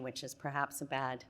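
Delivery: losing voice, rough and hoarse. Real, not acted. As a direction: talk through a fading hoarse voice. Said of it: "I'm losing my voice".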